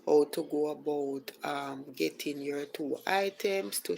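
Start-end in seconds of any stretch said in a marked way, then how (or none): none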